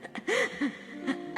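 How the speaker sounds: a high breathless half-sob laugh; breathy tearful laugh. Real, not acted. Preacher moved to tears, breathy tearful laugh. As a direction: let a choked laugh escape. After retelling protest.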